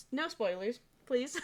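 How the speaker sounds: ordinary talk, nothing marked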